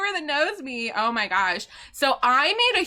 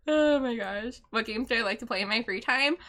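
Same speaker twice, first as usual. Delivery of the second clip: usual speaking voice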